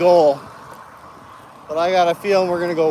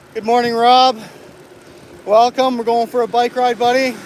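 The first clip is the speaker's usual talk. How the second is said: higher, friendly hello to a buddy; cheerful greeting; 6 semitones higher than usual. Real, not acted. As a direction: greet him warmly, lifted pitch. Greeting buddy Rob on ride.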